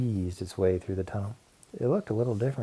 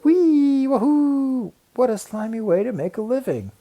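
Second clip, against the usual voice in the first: gleeful whee; 14 semitones higher, 7 dB louder, slower than usual. A narrator's reading. Teacher sliding down slime mold.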